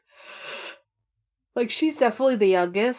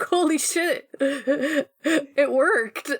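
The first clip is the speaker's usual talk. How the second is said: higher-pitched, amused disbelief; imitated amazement; 6 semitones higher, much slower than usual. Three kids finding their long-shot plan worked, imitated amazement. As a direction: playfully mimic amazed kids, about to laugh.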